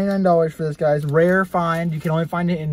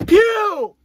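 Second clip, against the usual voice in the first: excited shout; 13 semitones higher, 6 dB louder than usual. Real, not acted. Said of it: "what scares me".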